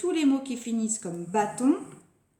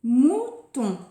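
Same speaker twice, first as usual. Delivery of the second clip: "Mouton" louder, in two short beats; word announcement; 3 semitones higher, 6 dB louder than usual. Shown as a demonstration. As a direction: present "Mouton" clearly with a brief gap. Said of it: "Un nouveau mot".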